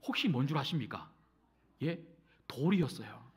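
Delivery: ordinary delivery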